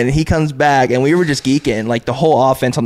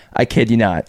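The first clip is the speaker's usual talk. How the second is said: ordinary talk, nothing marked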